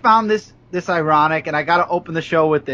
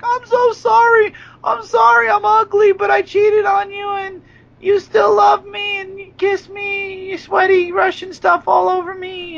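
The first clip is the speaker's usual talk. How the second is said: mock crying shout; 14 semitones higher than usual. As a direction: wail it like a blubbering soap heroine. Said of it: "Summer's like".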